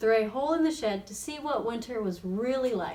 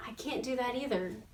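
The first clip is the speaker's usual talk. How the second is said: soft, sad little admission; sadly; 8 dB quieter than usual. A narrator's reading. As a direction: say it quietly, downcast. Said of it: "thought Shelley sadly".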